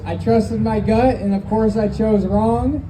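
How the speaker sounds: usual speaking voice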